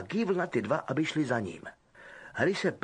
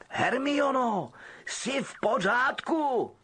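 high, slow call through the door; Harry calling to Hermione through the door; 11 semitones higher, much slower than usual. Acted. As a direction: ask anxiously through a closed door. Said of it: "zeptal se Harry přes dveře".

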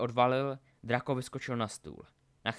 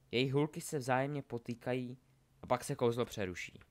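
usual speaking voice

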